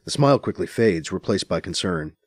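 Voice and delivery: ordinary delivery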